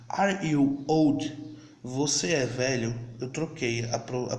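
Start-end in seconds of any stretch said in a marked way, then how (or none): 0.1-1.4 slow, carefully spaced model question; slow demo English. Shown as a demonstration. Teacher to students learning English, slow and deliberate. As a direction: spell it out slowly for beginners.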